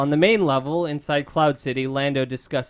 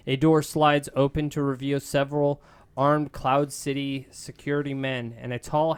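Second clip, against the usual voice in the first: plain reading voice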